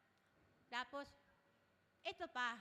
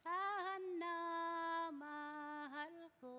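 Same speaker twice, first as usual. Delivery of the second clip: "Tahan na" high, "mahal ko" drawn out very slowly; a loving husband hushing his crying wife; tender hush; 3 semitones higher, 3 dB louder, much slower than usual. Retold or imitated. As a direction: soothe her like a husband would. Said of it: "Ito yung gustong sabihin ni tatay".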